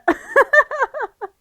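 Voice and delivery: a sudden loud cackle of amusement; loud laugh. Real, not acted. Cohost hearing the villain rides a Roomba, loud laugh. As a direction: burst out in a high, loud laugh.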